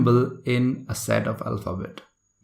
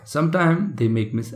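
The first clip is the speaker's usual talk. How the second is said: ordinary talk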